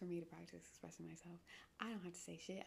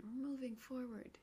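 hushed whisper, half to herself; whispered aside; 5 semitones higher than usual. Acted. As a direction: breathe it as a quick aside. Whispered repeat of moving on.

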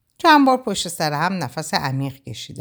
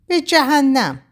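defiant outburst; 9 semitones higher, 5 dB louder, slower than usual. A narrator's reading.

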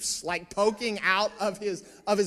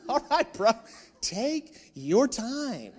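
sarcastic, laughing; 3 semitones higher, slower than usual. Retold or imitated. Sarcastic reply to slow agent.

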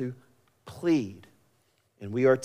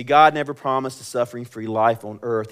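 usual speaking voice

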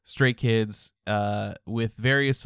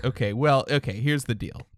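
ordinary delivery

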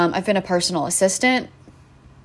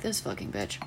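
low, much softer mock grumble; viewer's muttered insult; 11 semitones lower, 15 dB quieter than usual. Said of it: "You're like".

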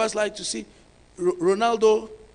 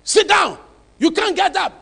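loud, raised, firm command; strong church voice; 6 semitones higher, 7 dB louder than usual. Retold or imitated. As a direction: order them down like a strict pastor. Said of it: "there's a strong voice".

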